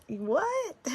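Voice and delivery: high-pitched, falling "what?"; mock disbelief breaking into a laugh; incredulous retold reaction. Wife baffled by husband liking her country voice, incredulous.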